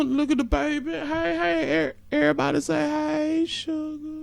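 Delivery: high, doting greeting to a little one; cooing. Real, not acted. Cooing over a baby on screen.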